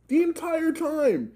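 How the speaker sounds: high, slow drawn-out mock glare; staring boss imitation. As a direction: ham up the creepy stare.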